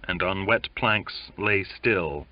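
ordinary delivery

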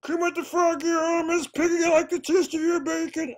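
very high, loud put-on puppet voice; a silly squeaky Muppet; bad puppet voice. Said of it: "two bad voice impressions".

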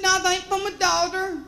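high-pitched, insistent country man; imitating a frantic South Georgia farmer; panicked farmer. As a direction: deny it urgently like a flustered good old boy. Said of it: "was in a little bit of a panic".

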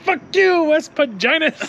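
very high yelled taunt; high mangled yell, out the window; mock yell. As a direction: squeal it out like a goofy buddy. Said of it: "And he's like".

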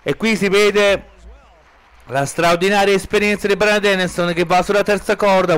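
ordinary delivery

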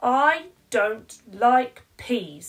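slow, loud flat refusal; stubborn child. Acted. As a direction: dig your heels in like a stubborn little girl.

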